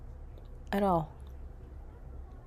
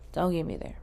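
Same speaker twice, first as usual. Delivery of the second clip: ordinary talk, nothing marked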